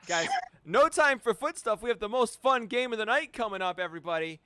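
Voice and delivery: loud, high game-show hype; hyped host. Real, not acted. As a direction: pump up the crowd. Host hyping the next game.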